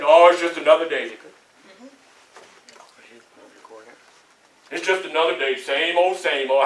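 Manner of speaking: low, weary mock reply; resigned everyday grumbler. Speaker mimics people answering a morning greeting, low and resigned. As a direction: imitate someone shrugging off the day. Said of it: "this is what they say".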